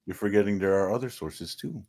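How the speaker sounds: ordinary delivery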